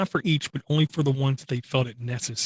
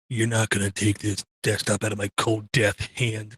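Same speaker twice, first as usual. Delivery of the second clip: low, put-on die-hard voice; stubborn die-hard; 4 semitones lower than usual. Podcaster mocking desktop die-hards who won't let go, low stubborn voice. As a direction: play a stubborn holdout, pitched down low. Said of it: "kind of grip mentality".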